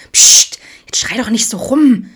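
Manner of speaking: irritated hush at a shouting friend; grumbling hush. A narrator's reading. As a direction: snap at him to keep it down. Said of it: "motzte Alex".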